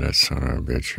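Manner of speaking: low, soft Bronx swear under the breath; a Bronx curse kept to himself; Bronx muttered curse. Retold or imitated. Thinking the Bronx curse to himself about the director. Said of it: "my Bronx came out of me".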